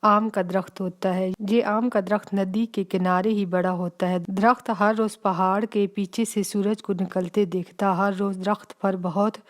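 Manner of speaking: usual speaking voice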